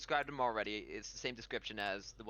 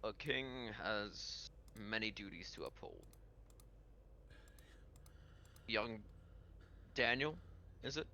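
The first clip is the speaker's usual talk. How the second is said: Pirate King; 7 dB quieter than usual. Acted. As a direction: take your time, softer, with long pauses. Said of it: "he chuckles and he goes".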